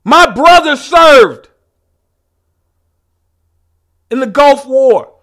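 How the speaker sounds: loud, high, indignant yelling; furious shouting rant. Acted. Comic telling the crowd off, in a loud indignant yell. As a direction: bellow your credentials like an outraged patriot.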